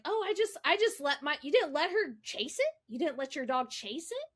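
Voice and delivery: incredulous groomer. Retold or imitated. Groomer to dog owner about chasing an armadillo, mock incredulous. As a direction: imitate a groomer shocked at the idea. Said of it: "And then the lady's like".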